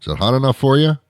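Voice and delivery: high, rising mock question; imitating a silly sarcastic questioner; stupid small-talker. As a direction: chirp it like a clueless small-talker. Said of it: "sarcastically stupid manner".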